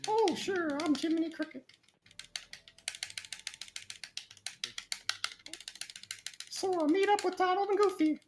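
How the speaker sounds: high, squeaky cartoon cricket voice; Jiminy Cricket. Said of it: "That's my Jiminy Cricket impression".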